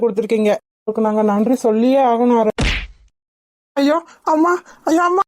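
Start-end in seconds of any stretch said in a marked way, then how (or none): 3.8-5.2 high-pitched yelps of pain; acted pained cry. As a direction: wail as the blows land. Man being beaten cries out.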